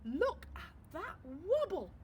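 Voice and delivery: slow, amazed cry of the judges; a touch of hammed-up amazement; excited judges. A narrator's reading. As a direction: marvel at it, drawing it out. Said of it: "cried the judges".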